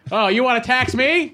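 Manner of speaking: pundit voice. Pretend. Mock political talk show.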